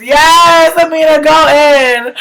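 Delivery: high, loud whoop of approval; hyped-up, hollered encouragement; shouted cheer. Real, not acted. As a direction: cheer her on at full volume. Cheering on Amina while watching.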